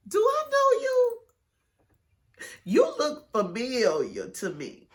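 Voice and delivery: squeaky high, curious mock voice; girl in the cell. Imitating a cellmate who thinks she recognises her, high. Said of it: "this girl in the cell was like".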